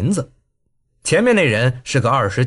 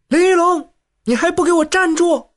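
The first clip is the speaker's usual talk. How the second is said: high shrill call; 12 semitones higher than usual. A narrator's reading. Young wife Ren Feiyan yelling at Lin Yulong, high shout. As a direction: shout him down from behind. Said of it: "少妇任飞燕叫道".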